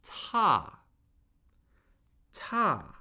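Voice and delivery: "ta" falling sharply from high; fourth tone. Shown as a demonstration. Teacher modelling tones for repeating, "ta" high then falling. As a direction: start "ta" high and drop it steadily. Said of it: "the fourth tone steadily falls".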